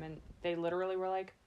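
normal talking voice

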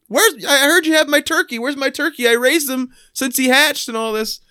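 high-pitched imitation of a child; little kid voice. Little kid at the door wants his turkey, high imitated child voice. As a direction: play a small kid asking after a lost pet. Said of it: "this little kid shows up".

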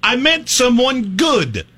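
high, slow, drawn-out retort; emphatic, stretched-out dismissal; exasperated. Real, not acted. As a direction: wail each word in exasperation. Mock exasperated retort to co-host.

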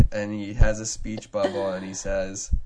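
ordinary delivery

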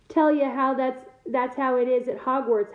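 ordinary delivery

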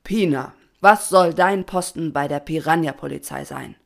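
loud, formal demand; stern question. A narrator's reading. Patron questions his daughter before the swarm, stern and loud. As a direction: put the question with authority. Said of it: "rief der Patron streng".

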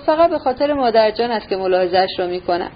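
ordinary delivery, nothing marked

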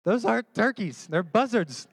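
exasperated correcting. Retold or imitated. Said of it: "And I'm like".

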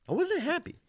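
squeaky, high-pitched insistence; high mock-indignant whine; comic protest. Comic repeat of his complaint.

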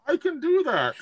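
Brewer eagerly agrees, high voice; Brewer's voice. Acted.

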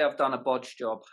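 native British "bodge job"; British demo phrase; British accent. Shown as a demonstration. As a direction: model the phrase in plain British.

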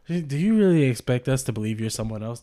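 ordinary talk, nothing marked

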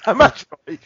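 ordinary talk

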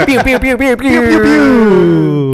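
playful zap noises; laser pew sounds. Pretend. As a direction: mouth blaster sounds.

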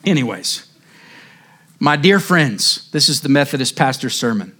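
usual speaking voice

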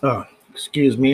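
ordinary delivery

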